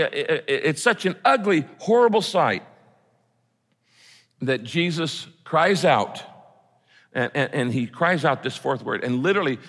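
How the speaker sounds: ordinary talk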